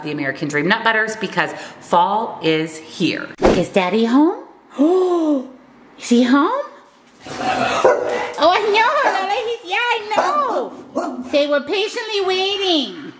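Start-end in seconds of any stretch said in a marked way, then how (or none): 3.4-8.5 high, eager voice for the dogs; excited dog talk. Real, not acted. 8.5-13.1 very high, sing-song pet voice. Real, not acted. Baby talk to dog Lola.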